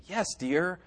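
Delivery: soft, understated yes dear; mild yes dear. Shown as a demonstration. Showing the congregation one tone of a reply, softly. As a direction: answer quietly and agreeably. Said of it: "there's a great difference between".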